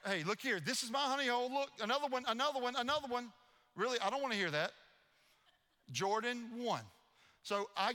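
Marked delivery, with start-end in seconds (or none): 0.1-3.3 high-pitched gloating imitation; imitated boasting, rapid-fire and higher; mocking brag. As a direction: crow over each catch, teasing. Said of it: "She mocked me, she bragged".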